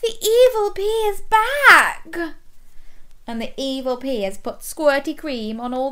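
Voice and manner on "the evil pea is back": high, slow wail of alarm; drawn-out, high cartoon panic; alarmed melons. A narrator's reading. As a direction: announce dreadful news as frightened melons. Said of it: "mouthed the melons".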